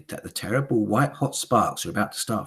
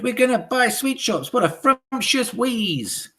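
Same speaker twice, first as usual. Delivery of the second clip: high, loud eager hag voices; high witch chorus; 5 dB louder than usual. Acted. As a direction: agree like fawning followers. Witches delighted.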